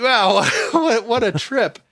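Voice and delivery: high, laughing amazement; giddy wow with a laugh; amazed. Retold or imitated. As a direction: gasp it out in delighted disbelief. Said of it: "I'm like".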